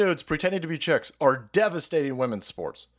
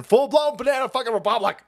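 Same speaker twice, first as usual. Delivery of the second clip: raised-pitch exclamation of outrage; exasperated outburst; 5 semitones higher, 3 dB louder than usual. Real, not acted. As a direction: burst out in exasperation, pitch jumping up. Outburst, then calmer repeat.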